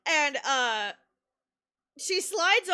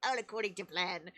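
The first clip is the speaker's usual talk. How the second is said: scheming villain; 7 semitones lower, 8 dB quieter than usual. Retold or imitated. Villainous aside after success.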